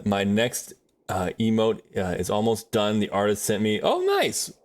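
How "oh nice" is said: pleased surprise. Real, not acted. Reacts to artist news.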